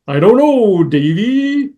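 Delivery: mock dopey cartoon dog voice; drawn-out hesitant cartoon dog; hammed-up doubtful claymation dog voice; Goliath the dog. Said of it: "So perfect".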